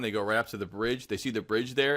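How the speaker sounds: ordinary talk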